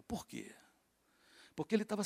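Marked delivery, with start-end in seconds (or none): 0.0-0.5 hushed whisper of a question; breathy secret asking why. Acted. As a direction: lean in and whisper it.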